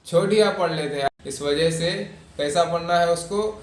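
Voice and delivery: ordinary talk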